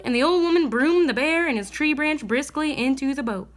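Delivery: usual speaking voice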